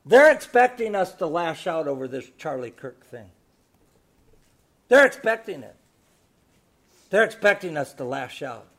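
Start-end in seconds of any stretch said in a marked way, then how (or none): 4.9-8.7 building preaching. Real, not acted. Pastor warning the church not to lash out, building loud. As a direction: rise to a forceful close.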